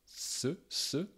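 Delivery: ce with the tongue tip placed as for French S; tongue-tip S demo; French-style S. Shown as a demonstration. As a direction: hiss the S with the tongue tip forward. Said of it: "placent la pointe de la langue comme on la place en français".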